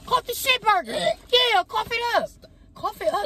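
a squeaky singsong jeer; high mocking taunt. Girlfriend taunting her boyfriend to cough it up, high mocking voice.

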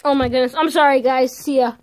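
loud, flustered apology. Real, not acted. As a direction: burst out, embarrassed. Flustered while the video won't pause.

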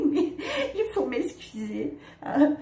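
rit en s'excusant, gênée; rire gêné. Real, not acted. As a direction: laisser échapper un petit rire d'excuse. S'excuse d'être narratrice moyenne. (petit rire embarrassé en s'excusant)

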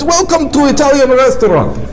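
put-on thick Russian accent, high; jovial Russian host voice. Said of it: "Greets you with thick Russian accent".

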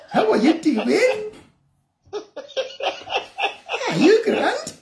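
laughing, hammed-up Scottish accent with a slow, high drawn-out question; mock Scottish accent. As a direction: put on a jokey Scottish accent for the caller. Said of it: "That is the way we speak it".

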